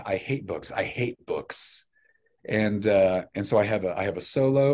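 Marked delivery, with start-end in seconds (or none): 0.0-1.9 lightly voiced grumpy book-hater, pitched low; a hint of a musical-villain dad; Mister Wormwood. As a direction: drop low and soft for a slow stage line. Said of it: "I have to bust out".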